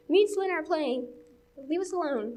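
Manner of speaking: high-pitched mock irritation; imitated annoyed sister. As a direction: play the older sister brushing off the younger. Imitates sister excluding her.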